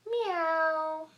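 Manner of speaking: long, drawn-out meow; playful cat imitation; kitty meow. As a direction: stretch it out like a cat. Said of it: "Kitties say meow".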